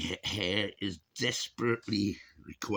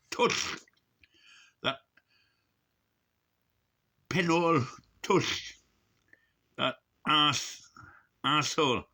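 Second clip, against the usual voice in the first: higher, louder jeering insults; mock insult; 8 semitones higher, 4 dB louder than usual. Hammy insults hurled at Dominic Raab in a vlog. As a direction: spit the names out for a laugh.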